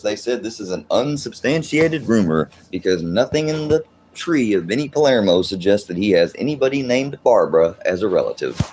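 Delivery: ordinary talk, nothing marked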